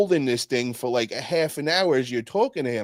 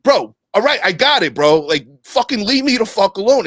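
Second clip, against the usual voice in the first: higher and louder, fed up and snapping; angry outburst; 8 semitones higher, 8 dB louder than usual. Retold or imitated. Losing it in the kitchen, retold angry outburst. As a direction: blow up at the guy who won't quit. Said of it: "he fucking lost it, uh, in the kitchen on me".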